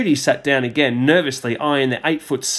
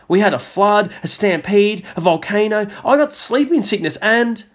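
put-on excited boy; 9 semitones higher, 3 dB louder than usual. Boy tells eager news.

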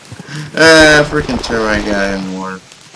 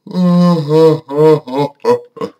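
higher, louder villain laugh that falls away; evil laugh; 5 semitones higher, 6 dB louder than usual. Pretend. Gamer hamming up a villain laugh at nobody, evil cackle. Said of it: "evil laugh right there".

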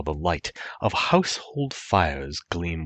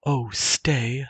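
higher, tender plea; maiden pleading; 8 semitones higher, 3 dB louder than usual. Acted. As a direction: hold him back gently. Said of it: "the maiden said".

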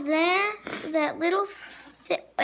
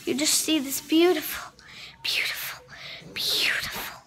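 whispering. Real, not acted. Whispering over the cake.

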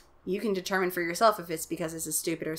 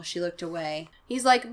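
normal talking voice

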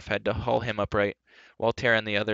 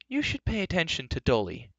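impatient; 8 semitones higher than usual. Acted. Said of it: "turning impatiently".

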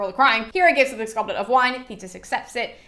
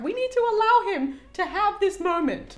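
higher, put-on indulgent god voice; mock Zeus; 10 semitones higher than usual. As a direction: play Zeus being lenient about the rage.

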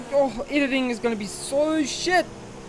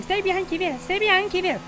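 lightly higher mock game announcer; 8 semitones higher than usual. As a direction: parrot the nagging game instruction. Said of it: "keeps on saying".

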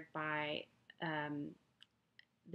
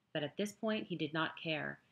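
ordinary delivery, nothing marked

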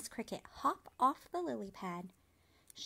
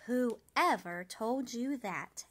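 slow, gentle question; old spider gently asking her sad friend; gentle concern; 2 semitones higher, 6 dB louder, much slower than usual. A narrator's reading.

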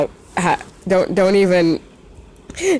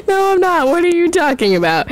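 laughing denial; 11 semitones higher than usual. Real, not acted. Denying, laughing at herself.

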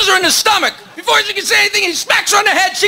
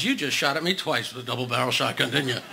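low gruff bear voice, much lower; 12 dB quieter than usual. Acted. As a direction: drop way down for the bear.

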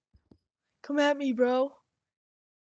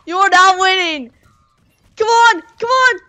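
loud, high screaming rage; raging gamer; 8 semitones higher, 16 dB louder than usual. Real, not acted. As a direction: scream at the screen. Losing final fight, screaming.

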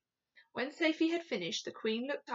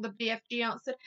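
ordinary delivery

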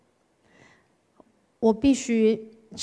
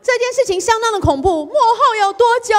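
much higher and louder, fast; alarmed outburst; 12 semitones higher, 7 dB louder, faster than usual. Real, not acted. Preacher tells the church, high mock horror. Exclaiming to congregation.

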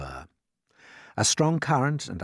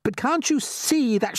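flustered James; 13 semitones higher, 5 dB louder than usual. Acted.

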